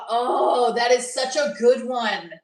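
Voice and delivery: usual speaking voice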